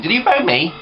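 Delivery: high-pitched imitation of gran calling back; put-on high voice for gran; gran on the phone. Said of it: "I'll get a phone call like an hour's time saying".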